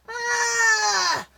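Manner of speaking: high, loud wailing ah. Acted. Bully Buzz going over the falls, a high loud wail. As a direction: let out a scared wail. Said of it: "wailed Buzz".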